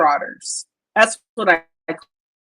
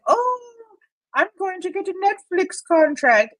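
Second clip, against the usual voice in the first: much higher mock childish boast; childish Jason; 10 semitones higher than usual. Mocking Jason dreaming of a Netflix contract, childish and much higher. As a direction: gush like a naive kid. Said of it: "He's like a child".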